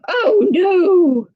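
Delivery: high alarmed "oh no"; boy's dismay. Acted. Reading to children, the boy cries out in dismay. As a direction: cry it out like a worried kid. Boy's thought voiced in story.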